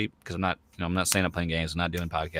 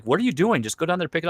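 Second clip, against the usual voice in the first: exasperated wife; 8 semitones higher, faster than usual. Retold or imitated. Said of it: "And my wife's like".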